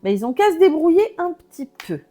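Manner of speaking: high, loud, careless shrug; dismissive. Retold or imitated. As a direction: toss off everyone else's problems. Voicing the card, dismissing others.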